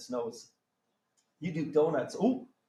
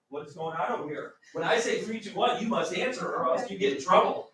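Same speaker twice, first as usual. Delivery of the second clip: usual speaking voice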